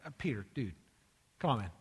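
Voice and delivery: low, soft, casual plea; retold low-key reproach; mild exasperation. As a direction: talk him round quietly and low. Jesus reasons with balking Peter.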